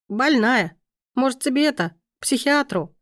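tipsy, slurred and giggly; barely getting her tongue around the words; drunk slurring. A narrator's reading. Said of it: "Осилив последнее трудное слово".

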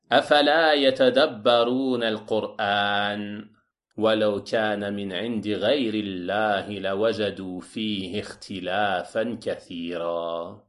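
firm opening, then a sinking chant-like recitation; Quran recitation. Acted. (récitation coranique, ferme puis descendante)

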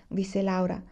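ordinary delivery, nothing marked

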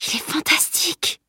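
high, hushed excitement; overexcited. A narrator's reading. Overexcited boy admiring a stranger to his friend, hushed. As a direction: keep it soft but pitched right up, thrilled. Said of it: "surexcité".

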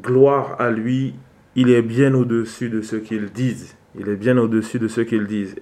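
ordinary talk, nothing marked

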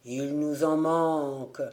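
hollow ghostly chant, falling at the end; sepulchral voice. A narrator's reading. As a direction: repeat it like a dead crew from the sea. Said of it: "d'une voix sépulcrale". (voix sépulcrale qui retombe)

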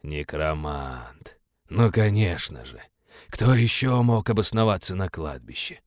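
slow, low disappointed demon; disappointed drawl. Acted. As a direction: drag out the letdown. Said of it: "разочарованно протянул".

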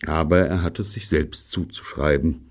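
usual speaking voice

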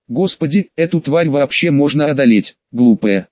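ordinary delivery

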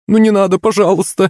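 high-pitched whiny plea; pleading boy. A narrator's reading. Boy running after the cleaning lady, pleading. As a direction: beg in a high, helpless voice.

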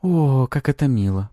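gushing succubus voice; drawn-out cooing. A narrator's reading. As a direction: stretch it out with teasing sweetness. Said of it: "протянула Пафи".

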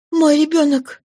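a high, frantic cry; alarmed exclamation. A narrator's reading. Fantine crying out for her child, high and frantic. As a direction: burst out in fear for her child. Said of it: "воскликнула она".

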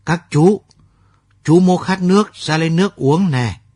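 put-on a child's high, loud call from outside. A narrator's reading. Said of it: "con Thắm kêu to bên ngoài". (gọi to, giọng cao vang)

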